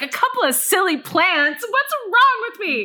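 high, incredulous self-reproach; appalled. Retold or imitated. Woman watching herself on camera, appalled. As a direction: rise in pitch, aghast at yourself. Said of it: "I was appalled".

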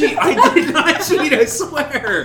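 shrieking laughing denial, high and loud; nervous bad liar. Real, not acted. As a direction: protest innocence while cracking up. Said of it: "what a bitch".

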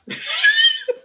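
sudden high embarrassed laugh; a real laugh caught out; burst of laughter. Real, not acted. Laughs at own drinking admission.

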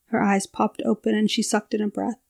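ordinary delivery

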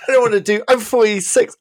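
high, loud whiny refusal; mock exasperated protest. Dad turns down his son's extreme frisbee, comically exasperated protest. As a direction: plead your age, hammed-up and put-upon.

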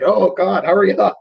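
lightly dramatized urgency; anxious urging. Acted. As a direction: act out an anxious viewer urging someone on. Acts out anxious viewer's reaction.

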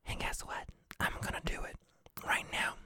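barely voiced, secretive whisper; hushed confession. Real, not acted. Host confiding wine plan to listeners, hushed whisper. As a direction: drop to a whisper, as if sharing a secret.

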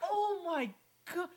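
loud, high, disgusted; disgusted exclaim. Real, not acted. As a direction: cry out in disgust. Disgusted by tiger photo.

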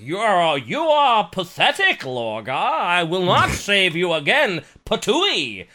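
loud, slow, disdainful royal voice; booming warrior king. Retold or imitated. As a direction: sneer down at him like a king. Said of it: "he's like".

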